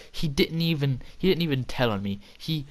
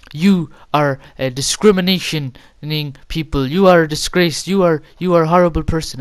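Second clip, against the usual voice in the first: loud, raised-pitch teacher tirade; imitated scolding; 3 semitones higher, 9 dB louder than usual. Staff member condemns student as a bully, loudly scolding. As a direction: berate the student, hammed-up and loud.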